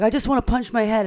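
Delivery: ordinary delivery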